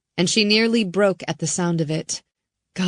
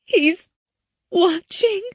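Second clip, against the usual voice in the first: much higher, breaking whisper of dread; voice cracking with fear; 13 semitones higher, 3 dB louder, slower than usual. Acted. Girl warning Riley, voice cracking. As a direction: let the fear crack the words. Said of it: "Hannah's voice cracked with fear".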